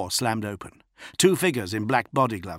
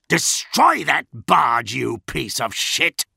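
slow, loud, angry command; furious order; 4 semitones higher, 6 dB louder, slower than usual. A narrator's reading. Commander berating the officer he slapped, furious. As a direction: spit it out word by word.

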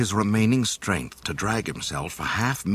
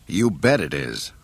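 plain reading voice

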